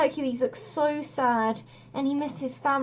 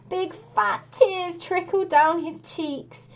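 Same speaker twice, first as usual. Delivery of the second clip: usual speaking voice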